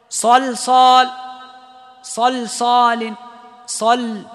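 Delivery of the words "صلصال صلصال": صلصال slow, both lams thin between heavy sads; light lam. Shown as a demonstration. Teacher modelling صلصال for students, slow. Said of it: "رقق اللام".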